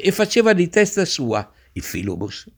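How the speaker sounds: plain reading voice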